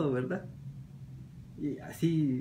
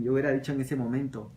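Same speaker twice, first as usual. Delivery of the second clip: ordinary talk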